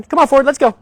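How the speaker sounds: loud, high "come on, Ford, let's go"; trainer's bright go command; firm upbeat command. Real, not acted. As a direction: call the dog forward with energy. Commanding the dog.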